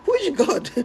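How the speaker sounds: high laughing mock question; high mocking laugh at the brother. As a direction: laugh it out, incredulous. Pastor mocked, laughing.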